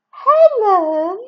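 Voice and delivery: high, loud squeak; strange squeaky voice. Acted. Katie on the phone, squeaking her sister's name. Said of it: "in a strange squeaky voice".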